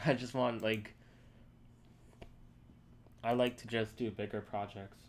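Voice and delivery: ordinary talk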